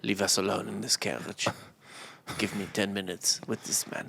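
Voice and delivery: low, hushed command; hushed and urgent. Retold or imitated. Voicing Napoleon, hushed.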